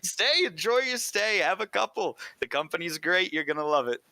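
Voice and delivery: high, jovial innkeeper voice; Glenn the innkeeper. Acted. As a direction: charm the guests into staying. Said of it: "he says".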